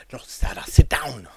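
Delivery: put-on loud Jamaican-accented shout; Jamaican dude. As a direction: bark it like the Jamaican guy in the film. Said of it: "That's how he sounds to me".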